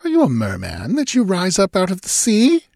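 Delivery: high-pitched wondering question, rising at the end; confused woman. A narrator's reading. Sancha asks the man from the sea, high and puzzled. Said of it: "confused by the candor of his gaze".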